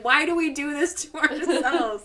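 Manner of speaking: high-pitched mock despair; mock exhausted lament. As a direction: wail it as a group's retold complaint. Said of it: "crying and we're all exhausted".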